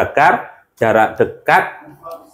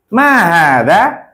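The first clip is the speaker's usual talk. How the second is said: "Ma hada" slow, higher, lifted at the end; question intonation; 5 semitones higher, much slower than usual. Shown as a demonstration. Teacher asks about an animal, question intonation on "Ma hada". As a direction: draw out "Ma hada" as a rising question. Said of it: "Pakai intonasi pertanyaan".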